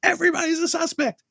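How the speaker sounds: lifted into a quoted character's voice; movie character quote. Retold or imitated. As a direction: toss off the character's line in a high voice. Quoting the movie character's line.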